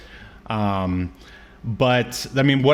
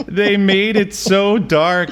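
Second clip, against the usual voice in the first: laughing; 9 semitones higher, 4 dB louder, slower than usual. Real, not acted. Laughing recounting scary PSA.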